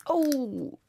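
ordinary talk

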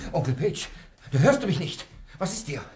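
high, loud frightened calling; loud high alarm at the fainting uncle. Acted. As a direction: shake him awake with your voice. Uncle suddenly collapses.

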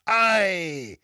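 sharp high-pitched call; shouting. A narrator's reading. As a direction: cry out a challenge. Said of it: "schrie ein Dritter".

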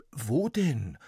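lightly voiced boy asking; a hint of a startled boy; boy Max, puzzled. Max asks.

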